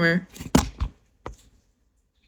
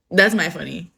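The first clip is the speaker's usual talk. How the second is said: normal talking voice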